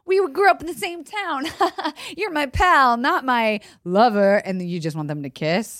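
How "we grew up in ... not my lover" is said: higher-pitched mock pal banter; chummy pals. Voicing two film friends from the same town, chummy banter. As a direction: play the childhood buddy, high then slowing. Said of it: "have got this, like".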